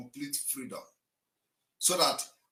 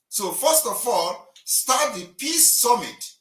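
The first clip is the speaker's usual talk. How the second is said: louder and higher, each word hammered slowly; slow emphatic outburst, pitched up; 9 semitones higher, 4 dB louder, slower than usual. Real, not acted. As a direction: lean into it and drive the point home. Heated argument on live call-in.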